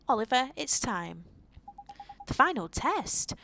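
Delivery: ordinary delivery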